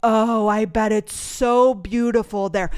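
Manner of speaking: lightly voiced gushing stranger; mocking imitation. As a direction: parody a naive stranger. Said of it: "they go".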